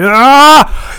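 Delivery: sudden loud, high cry of rage; angry yell. Pretend. Taking the angry stick on a podcast, angry yell. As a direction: burst out with a furious "Ah!".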